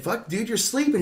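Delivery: high, urgent warning; panicked high call; alarmed. Retold or imitated. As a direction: jolt the driver awake. Alarmed at drifting driver.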